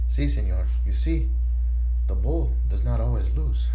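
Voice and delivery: put-on low, lightly Spanish-accented punchline; a hint of a smug Spanish waiter.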